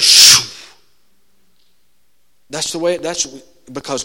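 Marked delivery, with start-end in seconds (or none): 0.0-0.4 a sharp whoosh of air; whooshing sound. Retold or imitated. Preacher mimics the room's sound with a whoosh. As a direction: blow out a quick whoosh. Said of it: "it goes".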